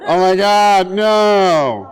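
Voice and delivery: long drawn-out mock bawling; hammed-up crying meltdown; hot-mess wail. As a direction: wail it like a blubbering kid. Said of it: "you become a hot mess".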